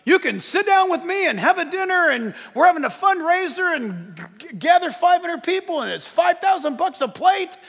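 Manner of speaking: high, loud fundraiser pitch; campaigning politician. Retold or imitated. Politician inviting donors to a fundraiser dinner, high and loud. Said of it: "politicians right now, they're all out there".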